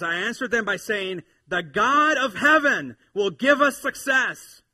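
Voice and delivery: slow down and ring out the answer; emphatic pulpit quote; bold declaration. Acted. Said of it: "It's so matter of fact".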